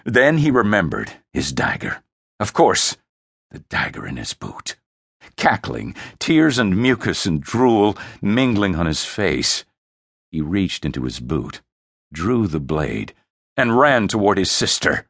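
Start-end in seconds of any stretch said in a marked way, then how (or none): none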